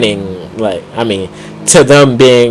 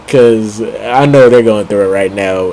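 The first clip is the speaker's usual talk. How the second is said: ordinary delivery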